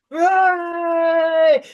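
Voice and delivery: a big high shout of joy for the crowd; crowd cheering. Acted.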